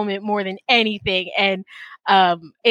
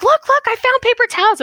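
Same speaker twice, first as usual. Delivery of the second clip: much higher and louder, thrilled; excited find; 4 dB louder than usual. Retold or imitated. Showing husband the paper towels, thrilled and high. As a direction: show off the find like a prize. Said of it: "I'm like".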